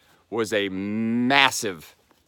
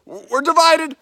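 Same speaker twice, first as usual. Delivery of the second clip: higher and louder, thrilled; excited; 6 dB louder than usual. Real, not acted. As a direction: burst out with real joy. Straddling the state line.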